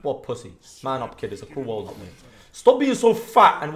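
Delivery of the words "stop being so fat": higher, louder, slow taunting yell; mock yell. Real, not acted. Streamer yells a taunt at an enemy champion, loud. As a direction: bellow the insult at the screen.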